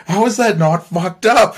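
high, loud disbelieving cry; wife retold, shocked and rising; shocked outrage. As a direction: voice the wife's alarmed disbelief. Wife upset after gun offer.

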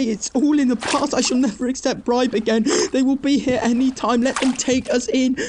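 high, frantic begging to be let go; desperate, breathless terror, voice pitched far up; panicked pleading. Acted. Raid begs Edwin to release him before drowning, panicked. Being dragged into the lake.

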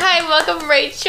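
overly sweet, bright host greeting; fake sincerity. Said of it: "I know I sound really fake right now".

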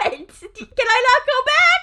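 high, loud, drawn-out protest; exasperated. Real, not acted. Host interrupted by laughter mid-bit, exasperated protest. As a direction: plead loudly and slowly in exasperation.

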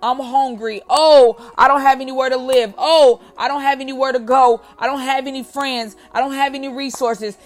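mocking whiny excuse-makers. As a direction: whine out each excuse mockingly.

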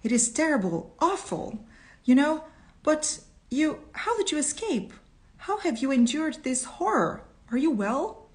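high-pitched, distressed questioning; acted dismay, pitch lifted well up; anxious concern. A narrator's reading. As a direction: fret over a loved one who survived. Reunited lover's anxious questions, acted.

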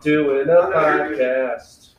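slow, stretched-out announcement; announcing to a visitor. Real, not acted. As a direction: draw it out for the person walking in. Addressing someone who walked in.

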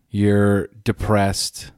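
normal talking voice

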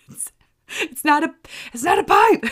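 high laughing, it's not a pipe; real laughter correcting herself; laughing correction. Real, not acted. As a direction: laugh it out, squeaky and loud. Laughing at own mislabeling.

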